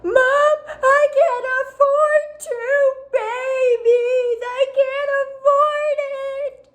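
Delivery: high, drawn-out mock wail; exaggerated crying. Pregnant daughter wailing to her mom over two babies, exaggerated crying. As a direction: bawl it theatrically, dragging out each word. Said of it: "is me crying".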